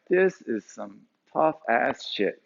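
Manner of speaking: drawn out very slowly, matter-of-fact; slow deadpan. Retold or imitated. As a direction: let each word land, unbothered. Bob pausing mid-work.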